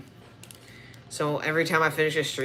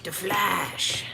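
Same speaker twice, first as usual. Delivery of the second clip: put-on hero introduction voice; The Flash; 3 semitones higher, 3 dB quieter than usual. Barry Allen introducing himself as fastest man alive, put-on hero voice. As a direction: announce yourself as a hammed-up superhero. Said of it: "I'm Barry Allen".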